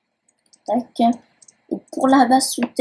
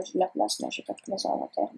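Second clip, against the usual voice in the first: muttered aside; 2 semitones lower, 11 dB quieter, faster than usual. Real, not acted. Checking his full inventory mid-tour, whispered mutter. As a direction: whisper it under your breath as if nobody's listening.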